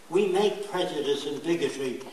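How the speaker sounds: normal talking voice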